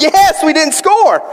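high, slow relieved cheer; retold burst of game-night glee; excited cheer. Recounting game, cheering non-score.

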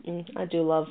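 quiet, savoring a drink; just after a sip. Real, not acted. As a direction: talk right after swallowing a sip. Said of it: "pause and take another sip of my matcha latte".

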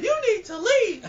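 very high, loud mimicked shriek; lady from viral clip. Host imitating the lady from the Twitter clip, high loud mock shriek. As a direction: imitate her screeching the order at full pitch. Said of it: "when the lady be like".

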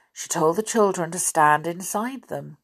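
ordinary delivery

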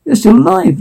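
pitched high in happy disbelief; high, incredulous relief at finding them alive; can't believe they survived, voice way up high; amazed disbelief. Acted. Finding the companion still alive.

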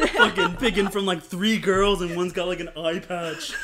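laughing while talking, slow. Real, not acted. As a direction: get the words out through laughter. Laughing mockery of dating options.

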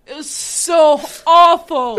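slow, loud drawn-out "awful"; emphatic disgust. Real, not acted. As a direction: drag out your disgust. Arguing over Matt Damon scene.

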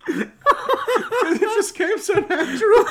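speaking through real laughter; laughing hard. Real, not acted. Cracking up at himself.

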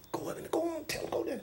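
hushed, furtive prompting; sneaky whisper. Acted. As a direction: whisper orders behind someone's back. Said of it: "start whispering in David's ear".

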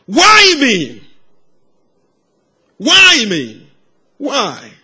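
high, loud cry to God; anguished shout. Retold or imitated. Boy crying out to God by the riverside, anguished shout. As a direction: shout it upward, pitch soaring on each why. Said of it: "he sh-, kept shouting".

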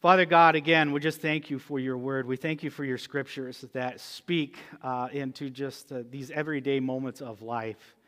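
soft, earnest prayer voice; closing prayer. Acted. Pastor leads the church in prayer, softly. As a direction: pray quietly over the congregation.

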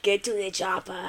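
low, soft mock Austrian accent; Arnold impression. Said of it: "I wanna hear your Arnold".

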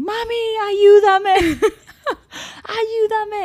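put-on high wailing kid voice; crying child. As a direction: mimic a sobbing toddler. Son crying in shower, imitated.

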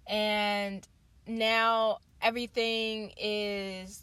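ordinary delivery

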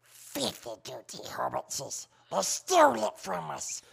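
high, loud goblin screech; Gollum. Shown as a demonstration. Showing friends an easy film voice, full goblin impression. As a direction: shriek it like a wretched, robbed goblin. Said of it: "Gollum was probably one of the easiest voices".

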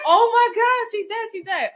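mock sob of panic over a passed-out cousin; wailing she's dead, high and tearful; panicked crying.

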